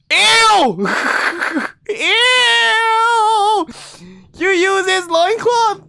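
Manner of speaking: very high, loud, drawn-out squeals; disgusted screech. Acted. Reader is appalled at the used loincloth, disgusted screech. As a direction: screech with revulsion, laughing between cries.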